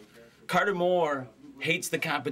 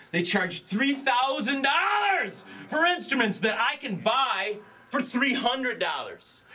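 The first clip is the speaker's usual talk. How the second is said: hammed-up rant; 7 semitones higher, slower than usual. Ranting pitchman shouts at competitors.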